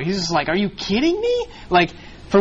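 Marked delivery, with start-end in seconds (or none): 0.5-1.5 high incredulous outburst, voice jumping up; exasperated disbelief. Retold or imitated. Paul reacting to the Corinthians' sin, shocked disbelief. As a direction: act out Paul's shocked, fed-up reaction. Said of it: "Paul is just flipping out".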